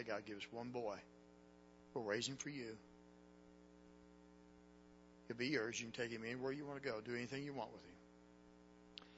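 hushed prayer. Retold or imitated. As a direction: murmur the prayer low, like at the bedside. Hushed nightly prayer retold.

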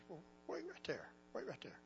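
a frail wife gently holding her husband back; feeble dying wife. Retold or imitated. Dying wife stops her husband, soft and weak. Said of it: "she lifted up her feeble hand".

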